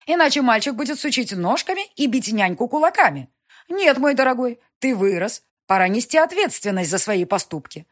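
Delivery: властная графиня, громче и выше; мать поучает сына, с напором; напористая мать. Acted. Mother lecturing son. (властная мать, повышенным тоном)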